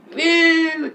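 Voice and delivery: hammed-up booing; mock jeer. [name] defies the teacher, loud mock boo.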